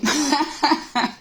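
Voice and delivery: short run of chuckles; amused laugh. Real, not acted. Reader amused by question about people looking down, laughing.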